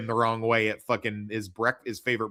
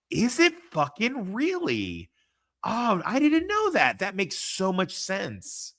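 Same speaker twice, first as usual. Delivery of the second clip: surprised; 8 semitones higher, slower than usual. Real, not acted. Surprise at a casting fact.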